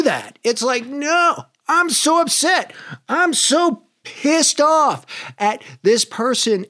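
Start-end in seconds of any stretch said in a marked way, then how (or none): 0.8-2.7 much higher, whiny mock outrage; hammed-up indignant whine; mock upset. Ego voicing upset self. 3.1-6.6 much higher, slow hammed-up fury; stretched mock rant with a pout; mock outrage. Ego voicing upset self.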